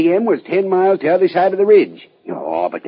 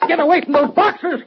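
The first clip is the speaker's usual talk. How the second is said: shout; 12 semitones higher than usual. Acted. Holding men at gunpoint.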